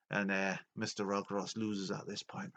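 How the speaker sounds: ordinary talk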